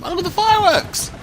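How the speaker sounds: high, loud delighted exclaim; thrilled at the fireworks; excited. Real, not acted. City hall upgrade fireworks.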